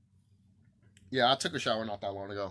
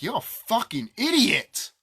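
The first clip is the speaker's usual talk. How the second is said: mock insult; 9 semitones higher, 5 dB louder than usual. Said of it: "And I'm over here like".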